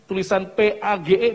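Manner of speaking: normal talking voice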